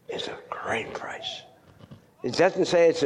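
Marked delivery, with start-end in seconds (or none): 0.1-1.4 whisper. Real, not acted. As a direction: drop to a slow hushed whisper. Preacher whispers key phrase.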